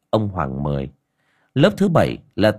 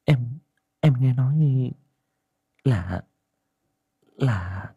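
higher, hushed village aunt voice, speeding up; a younger aunt confiding her fears, quicker as she goes; worried aunt; 4 semitones higher than usual. A narrator's reading. Aunt Liên telling Ngà about the child, worried and hushed.

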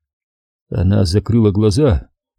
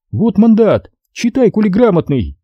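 властная девушка; 10 semitones higher than usual. A narrator's reading. Надя суёт мандат.

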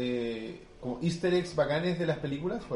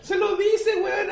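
high, loud, incredulous shout; excited outburst; 6 dB louder, slower than usual. Real, not acted. Telling friends about the movie spoiler, excited shout. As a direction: burst out in disbelief at the spoiler.

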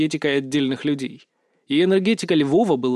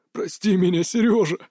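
near sobbing; 3 semitones higher than usual. A narrator's reading. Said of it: "Заварский почти рыдал".

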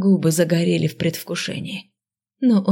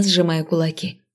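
ordinary delivery, nothing marked

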